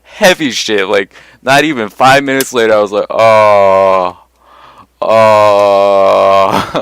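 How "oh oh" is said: loud drawn-out dazed moans; drugged moan. Retold or imitated. After the heavy shot kicks in, loud dazed oh moans. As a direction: sink into the heavy drugs, moaning. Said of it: "like that".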